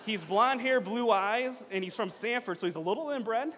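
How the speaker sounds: loud, playful list; playful roast. Real, not acted. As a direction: tease the groom playfully. Comparing groom to golden retriever.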